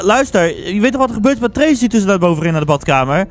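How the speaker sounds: ordinary delivery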